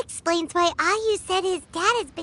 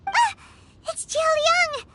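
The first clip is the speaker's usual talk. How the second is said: sudden high yelp of surprise, then the name; startled surprise; 14 semitones higher than usual. Acted. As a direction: caught off guard, voice jumps up. Paimon startled by sudden arrival.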